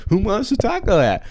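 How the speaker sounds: high, squeaky mock clown sing-song. Mocking the pushy clown who won't leave, in a high squeaky sing-song. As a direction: parody the annoying clown at a ballgame.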